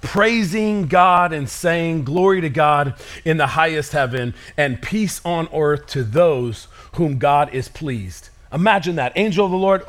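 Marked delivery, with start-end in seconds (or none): 2.0-8.3 slow, hushed reading. Acted. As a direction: draw out the praise slowly. Reading praise.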